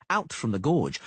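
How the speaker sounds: plain reading voice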